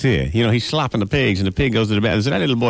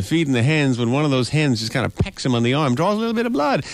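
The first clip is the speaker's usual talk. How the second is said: ordinary delivery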